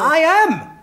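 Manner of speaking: sharp, high burst of hurt protest; indignant protest. Acted. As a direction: jump up in pitch, stung. Insulted by his ally doubt.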